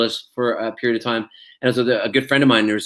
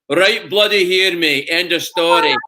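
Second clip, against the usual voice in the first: slow, higher Scottish proclamation; Scottish accent; 5 semitones higher, slower than usual. Retold or imitated. Actor answers Glasgow fans holding up scarf, proud Scottish accent. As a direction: proclaim it proudly in broad Scots. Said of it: "I'm like".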